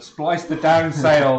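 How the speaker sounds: shouted sailing order. Acted.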